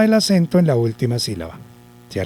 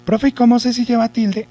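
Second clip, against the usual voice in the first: high-pitched pupil's question; imagined pupil; 8 semitones higher than usual. Acted. Pupil asks teacher about the written accent, imagined pupil voice. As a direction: mimic a pupil piping up, much higher.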